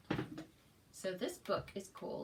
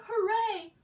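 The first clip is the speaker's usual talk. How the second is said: cheer; 13 semitones higher, 12 dB louder than usual. Acted. Bear cheering.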